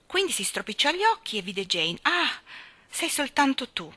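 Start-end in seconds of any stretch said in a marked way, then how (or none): 2.0-3.9 high, let-down exclamation; disappointed exclamation. Acted. Gardener finding only Jane, disappointed. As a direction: sound let down that it is only her. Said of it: "esclamò deluso".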